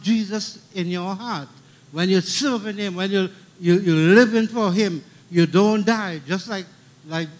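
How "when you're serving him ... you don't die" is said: usual speaking voice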